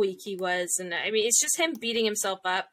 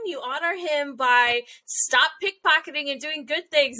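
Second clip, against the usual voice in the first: ordinary talk, nothing marked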